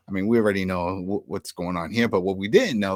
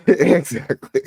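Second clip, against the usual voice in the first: laughing; 5 semitones higher, 7 dB louder, slower than usual. Real, not acted. Laughing reaction.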